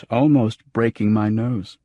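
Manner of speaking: plain reading voice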